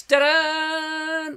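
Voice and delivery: high, sung-out ta-da fanfare. Pretend. Host announcing a viewer's new video with a high fanfare ta-da. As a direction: trumpet it like a fanfare.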